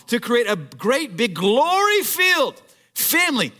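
high, emphatic pulpit delivery with stretched key words; impassioned preaching. Real, not acted. Preacher tells the congregation why Jesus suffered, impassioned and emphatic. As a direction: drive each phrase home to the congregation.

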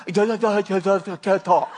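mock tongue-tied stammering; stutter. Speaker tells the voice she can't talk, exaggerated slow stutter. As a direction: get stuck on repeated syllables, slowly. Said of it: "I stut-, stutter really bad".

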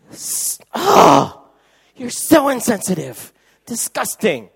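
high, loud, shrieking disgust; mock outrage. Wife scolds her distracted husband, high loud mock outrage.

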